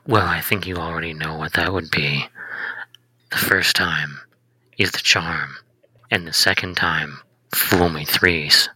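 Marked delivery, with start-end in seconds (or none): 1.1-8.7 breathy villain whisper; seductive whisper. Acted. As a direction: lean in and whisper it.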